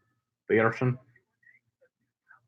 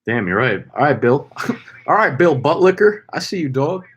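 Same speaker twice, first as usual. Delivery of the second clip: ordinary talk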